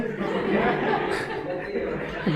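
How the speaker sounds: a quiet, airy chuckle; breathy laugh. Real, not acted. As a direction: laugh softly at your own joke. Teacher laughs at his own joke. (धीमी साँस भरी हँसी)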